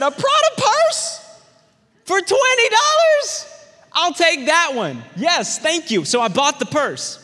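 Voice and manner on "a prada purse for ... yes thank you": very high, slow, astonished shout; can't believe the bargain; amazed. Retold or imitated. Teen amazed at twenty dollar price.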